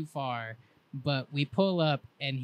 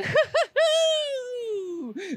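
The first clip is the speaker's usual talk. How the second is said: high, loud cackling laugh; wild cackle; 9 dB louder than usual. Retold or imitated. Chase cackling wildly outside after the acid drop. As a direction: cackle like a maniac. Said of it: "like, cackles".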